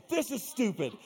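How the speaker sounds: high-pitched exasperated complaint; acted-out fuming protest; mad. Retelling his argument with God, mad. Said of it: "I was so mad. I was furious".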